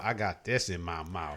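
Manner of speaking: low, soft mouth-full mimic; teasing echo of her mouthful; imitating her. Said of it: "I need to sound bite that".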